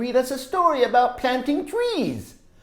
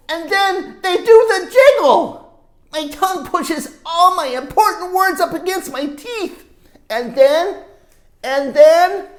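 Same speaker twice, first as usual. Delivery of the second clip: higher and louder, slowing to the burst; building excitement; 10 semitones higher, 8 dB louder, slower than usual. A narrator's reading. Teacher reading, building to the volcano moment. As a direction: climb toward the eruption.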